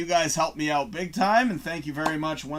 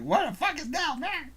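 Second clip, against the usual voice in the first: imitating his lady; 11 semitones higher than usual. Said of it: "call my lady".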